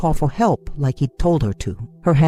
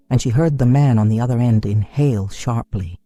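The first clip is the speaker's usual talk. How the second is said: ordinary delivery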